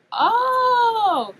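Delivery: high, loud, long-held "oh"; hammed-up cry of sudden understanding; dawning realisation. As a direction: act out the penny suddenly dropping. Retelling the moment she realised.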